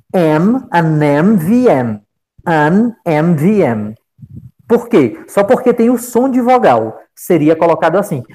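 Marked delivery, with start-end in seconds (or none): 0.7-3.9 "an M V M" said slowly, with "an" running into the vowel sound of "M"; demonstrating "an". Shown as a demonstration. Teacher modelling the article before initials, slow and careful. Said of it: "eu colocaria an".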